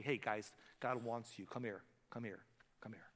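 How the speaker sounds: soft, low, inviting; quiet, tender welcome; welcoming Jesus. Acted. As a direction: gently call them in.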